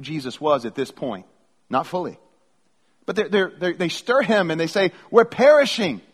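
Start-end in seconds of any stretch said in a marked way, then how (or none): 5.1-6.0 high, loud panicked cry; raised, urgent call for help; freaking out. Acted. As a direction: cry out in acted alarm. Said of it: "they were freaking out".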